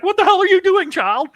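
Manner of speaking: hammed-up outraged guardian; shocked stern guardian. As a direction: yelp at the child in disbelief. Ferdinand shocked at her bathing.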